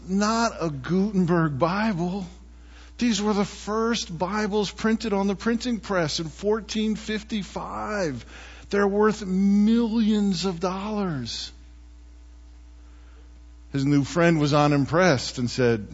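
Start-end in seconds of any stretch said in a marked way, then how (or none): 0.1-11.5 aghast disbelief. Retold or imitated. Book lover stunned by tossed Bible.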